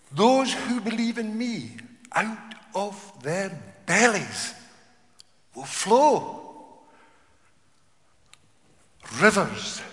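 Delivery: loud, high, slow pulpit reading; proclaimed scripture. Acted. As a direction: lift the verse up high.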